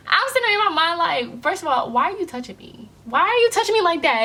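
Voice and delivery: high-pitched annoyed inner rant; indignant. Retold or imitated. Annoyed woman silently questioning the stranger tapping her, indignant. Indignant reaction to being touched.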